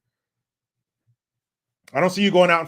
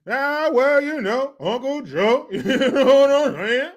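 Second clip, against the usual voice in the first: Barry Obama; 7 semitones higher, 4 dB louder than usual. Retold or imitated. Host parrots politician on Uncle Joe, mocking impression. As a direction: exaggerate the crowd-pleasing politician, stretched and high. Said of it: "Sound like fucking, uh, Tracy Morgan".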